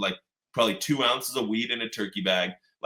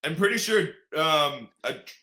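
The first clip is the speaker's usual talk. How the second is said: higher, unsure mock guard; 8 semitones higher, 4 dB louder, slower than usual. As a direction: check in uncertainly with a partner. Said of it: "He's like".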